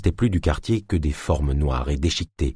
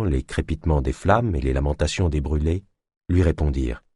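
plain reading voice

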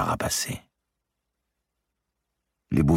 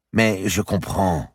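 higher and slow, understanding; slow sympathetic lady; 7 semitones higher, slower than usual. A narrator's reading. Madame de Bouville sympathizing with Marie, slow and higher. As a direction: soothe her, taking your time.

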